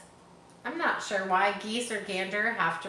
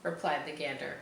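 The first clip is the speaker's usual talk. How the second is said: plain reading voice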